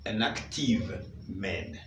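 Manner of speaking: an active man spoken slowly to be copied; English model phrase. Shown as a demonstration. Teacher modelling the English phrase. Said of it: "un homme actif".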